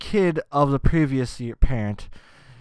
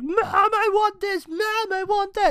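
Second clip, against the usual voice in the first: put-on bratty kid yelling. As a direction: whine it like a spoiled kid in a grocery store. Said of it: "screaming, m-, fucking yelling".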